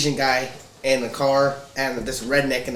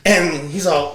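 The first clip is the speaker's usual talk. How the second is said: normal talking voice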